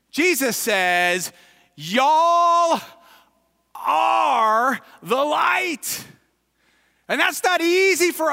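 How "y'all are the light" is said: "Y'all", "are" and "light" each high, loud and drawn out; great emphasis. Acted. Preacher quoting Jesus to the congregation, emphatic proclamation. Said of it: "he says it with emphasis".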